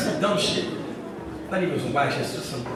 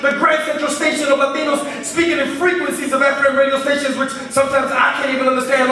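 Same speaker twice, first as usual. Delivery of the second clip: driving slam poem delivery, higher and louder than chat; spoken word poetry; 10 semitones higher, 5 dB louder than usual. Acted. Poet to the audience about the children of the wind, rhythmic slam delivery.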